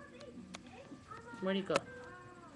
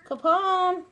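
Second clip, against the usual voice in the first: louder call, pitched up, to fetch the dog; 6 semitones higher, 8 dB louder than usual. Real, not acted. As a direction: call Capone over from across the room. Calling the dog by name.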